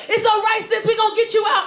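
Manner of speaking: loud, high-pitched promise; rally her in a hammed-up rescuer's shout; urgent reassurance. Rescuer promises trapped sis he'll free her, urgent reassurance.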